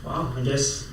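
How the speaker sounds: deflated like your world just fell apart; mock despair. Mock shock at stretching news.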